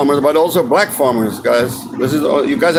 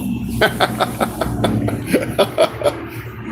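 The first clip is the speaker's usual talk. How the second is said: laugh; 5 semitones higher, 6 dB quieter than usual. Real, not acted.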